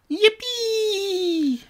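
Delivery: high, gleeful yippee; high excited cheer. Pretend. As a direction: squeal it like a thrilled kid. Cheering at the Star Wars toys.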